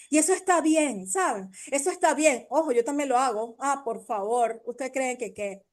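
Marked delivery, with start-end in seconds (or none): none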